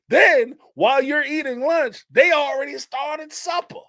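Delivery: marvelling at how the food never stops; amazed telling. Real, not acted. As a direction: pitch climbing high with amazement. Marvelling at endless southern meals.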